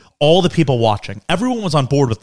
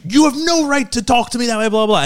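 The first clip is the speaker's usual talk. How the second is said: high-pitched mock ranting; retold outrage trailing into dismissive babble; indignant woman; 10 semitones higher than usual. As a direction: imitate a stranger's haughty protest. Imitating woman still ranting at dad.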